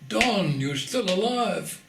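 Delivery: high, loud cry of astonished recognition; imitating an excited shouted greeting. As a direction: call out in excitement, drawing out the words. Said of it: "a very excited voice shouting".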